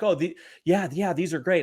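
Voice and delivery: eager kid, lightly imitated; mildly excited imitation. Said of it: "he's all excited and he's smiling".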